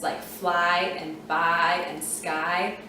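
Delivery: slow, careful "fly", "buy", "sky" with long I; long I demo. Shown as a demonstration. Teacher modelling the long I for students. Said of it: "Can you hear how that Y says the long I sound?".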